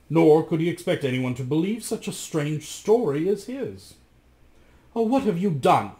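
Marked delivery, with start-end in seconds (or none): 5.0-5.9 higher and a little louder; cried out. Acted. Giulietta on the stairs cries out in alarm. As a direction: cry out in alarm. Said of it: "cried Giulietta".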